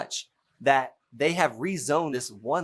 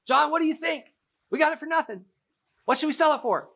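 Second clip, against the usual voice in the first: much higher, louder mock wife voice; Lisa voiced; 3 dB louder than usual. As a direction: play the wife asking her husband, pitched way up. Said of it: "Lisa's gonna talk to maybe John and ask".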